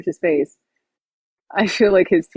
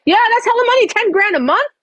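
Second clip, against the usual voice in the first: amazed; 13 semitones higher than usual. Real, not acted. Marvelling at the money.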